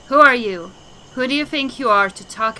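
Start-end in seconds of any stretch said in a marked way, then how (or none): 0.1-0.7 indignant challenge. Acted. Angry disciple confronts the madman.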